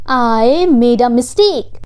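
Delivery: "I made a mistake" said slowly; slow repeat. Shown as a demonstration. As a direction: stretch each word of "I made a mistake". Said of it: "slow repeat for learners".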